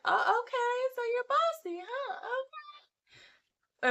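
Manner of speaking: high, mock-knowing sarcasm; wife mocking her bossy husband, high sarcastic voice. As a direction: tease him for being bossy, voice up high. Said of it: "She's like".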